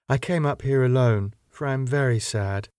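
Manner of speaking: usual speaking voice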